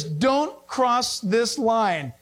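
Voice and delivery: very slow, word by word warning; sign read-out. Acted. Preacher reading a trespass sign aloud, slow and deliberate. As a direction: spell out the warning slowly. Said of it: "The sign says".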